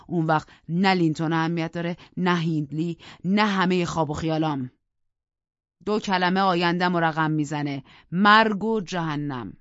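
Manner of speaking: passionate declaration. Acted. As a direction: swear it like a fatal oath. Heathcliff's passionate vow.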